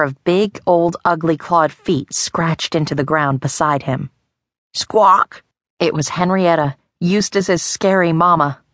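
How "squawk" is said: loud squawking cry; mother hen's loud squawk; angry hen squawk. A narrator's reading.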